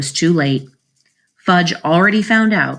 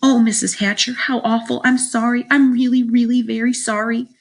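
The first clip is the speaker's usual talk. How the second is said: high, upset pleading; crying apology; 6 semitones higher than usual. A narrator's reading. Sheila apologises to the boy's mother, high upset cry. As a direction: gush apologies in a raised, distressed voice. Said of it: "Sheila cried".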